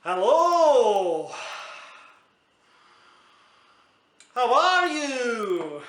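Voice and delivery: loud, high, drawn-out mock Scottish holler; hammed-up Scottish call, swooping high then falling; poor Scottish accent. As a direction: bellow a long greeting in a bad Scottish accent. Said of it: "this is my best poor, poor Scottish accent".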